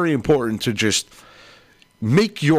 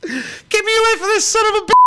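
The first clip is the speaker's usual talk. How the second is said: high squeaky puppet complaint; goofy muffled sock puppet. Acted. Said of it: "In the Puppet George voice".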